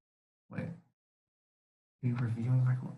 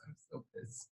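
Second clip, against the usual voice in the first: whispered; 22 dB quieter than usual. Real, not acted. Annoyed aside under breath.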